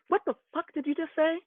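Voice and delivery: high-pitched outrage, re-enacted without full volume; retold yell. Sibling confronts man who insulted twin brother, retold angry yell.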